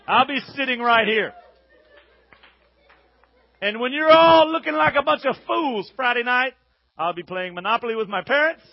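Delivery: loud, brash announcement to the whole school; mock proud announcement. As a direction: proclaim it to everybody in the room, loud. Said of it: "be like".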